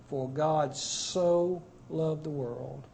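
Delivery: "so" drawn out slowly, softly; slow, soft verse with weight on "so"; stress on so. Shown as a demonstration. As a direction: linger on the word "so" in a hushed, slow line. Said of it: "most emphatic and expressive word in all".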